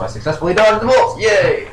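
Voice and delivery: high, loud real excitement; triumphant cheer. Real, not acted. Player celebrating a successful dodge, excited cheer. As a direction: crow it out, pitch soaring.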